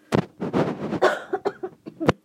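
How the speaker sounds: dry throat-tickle cough; coughing fit. Real, not acted. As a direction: cough a few times from a tickle. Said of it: "That's my coughing again".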